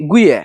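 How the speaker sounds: গুইয়া said in Manikganj dialect; dialect word. Shown as a demonstration. Villager showing viewers the local word for betel nut. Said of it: "আঞ্চলিক ভাষায় এটাকে বলে".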